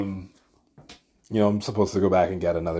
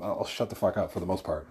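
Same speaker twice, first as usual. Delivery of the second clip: ordinary talk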